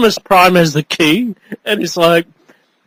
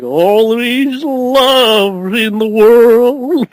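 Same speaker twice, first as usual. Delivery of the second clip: very slow quote; much slower than usual. Retold or imitated. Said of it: "he's like".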